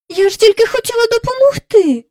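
put-on very high, loud little-girl protest; hurt child's protest. A narrator's reading. Rebuffed child protests to nanny.

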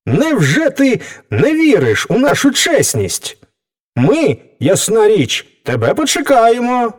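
high, affronted animal chorus voiced by one; high-pitched storybook indignation; indignant beasts. A narrator's reading. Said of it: "обурено вигукнули звірі".

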